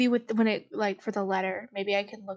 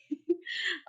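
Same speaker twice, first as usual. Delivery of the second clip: high giggle; 9 semitones higher, 5 dB quieter than usual. Real, not acted. Laughing at own joke.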